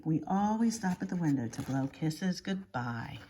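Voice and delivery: ordinary delivery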